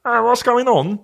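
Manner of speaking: very high mock British stammer; British stutter. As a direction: choke on words in a hammed-up British accent. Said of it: "Even when he stutters".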